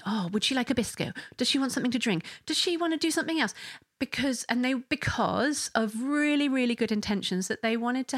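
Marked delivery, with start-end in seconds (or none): 0.0-3.5 rising, quickening mock of eager friends; sweet fussing helpers, higher and faster by the end. Friends crowd in offering biscuits, eager rising mock. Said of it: "they all sort of came over going".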